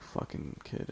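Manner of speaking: hushed, nearly voiceless aside; barely audible inner grumble; said in his head. Retold or imitated. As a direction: mutter it softly to yourself, as if only thinking it. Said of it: "I said it in my head".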